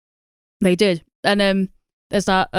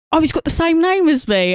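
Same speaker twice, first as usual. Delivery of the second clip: lightly voiced simpleton, pitched up; mock naive surprise; 9 semitones higher than usual. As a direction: imitate a clueless character's higher-pitched exclamation. Imitating a clueless film character.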